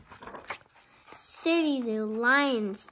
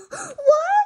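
shocked gasp; 6 dB louder than usual. Acted. Lily shocked that her parents fooled her, high gasp. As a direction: gasp then very high loud what.

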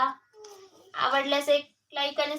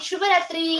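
ordinary talk, nothing marked

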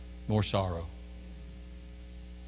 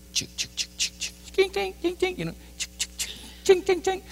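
soft chicks and high ringing chings; imitated cash register; 4 dB quieter than usual. Preacher mimics clerk ringing up items, register noises.

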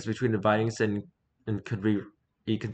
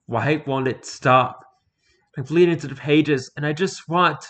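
desperate plea; 4 semitones higher, 6 dB louder than usual. Acted. Pleading bleeding voice.

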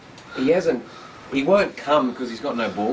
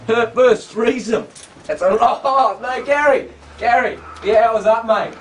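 laughing; 9 semitones higher, 9 dB louder than usual. Real, not acted. Laughing about the goat's first threesome.

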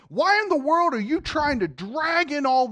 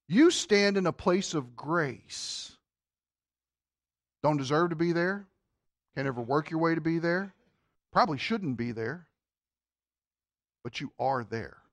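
low, soft, with long pauses; hushed, solemn preaching; 8 semitones lower, 6 dB quieter than usual. Real, not acted. Preacher telling congregation of grace, hushed and solemn. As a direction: drop lower and quieter with each phrase.

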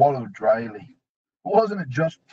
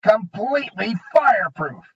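smug goblin; 8 semitones higher, 4 dB louder than usual. A narrator's reading.

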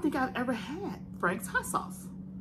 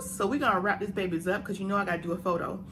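ordinary talk, nothing marked

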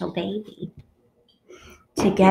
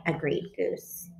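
ordinary delivery

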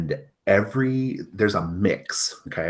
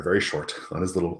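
ordinary delivery

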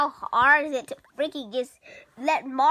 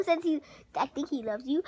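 normal talking voice

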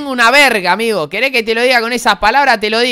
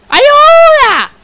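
piercing high scream for help, hammed up; pretend-panicked gamer screaming for help; shrieked cry for help; 7 dB louder than usual. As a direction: shriek it far above your voice, mock panic. Mock cry for help in game.

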